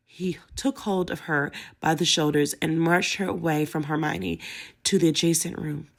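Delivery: usual speaking voice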